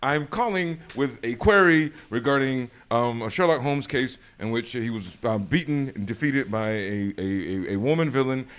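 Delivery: loud, over-eager formal phone introduction, faltering later; nervous, geeked caller. Retold or imitated. Geeked fan phoning a real British person, nervous and loud. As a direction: act out a starstruck caller getting tangled in the query. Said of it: "so nervous and so geeked to speak to an actual British person".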